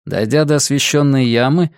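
usual speaking voice

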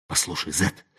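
hushed, scared whisper; frightened whisper. A narrator's reading. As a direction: whisper it in fear. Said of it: "испуганно зашептал".

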